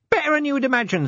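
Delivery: very high-pitched lowly copper; Nobby. Acted. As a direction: report back in a high character voice. Nobby reports to Vimes.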